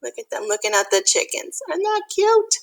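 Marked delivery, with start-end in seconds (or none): none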